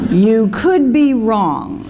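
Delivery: very slow, weighing each word; a slow, deliberate reading of the tattoo. Shown as a demonstration. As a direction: let each word land slowly.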